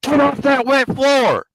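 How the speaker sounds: loud, high, slow shout; yelling. Retold or imitated. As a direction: bark it across the hangar. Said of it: "I heard somebody yelling".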